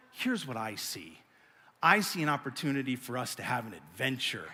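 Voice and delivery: low, soft, measured voice; calm reassurance. Retold or imitated. Wife offering her husband an adventure, calm reassurance. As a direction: imitate a patient spouse offering another view.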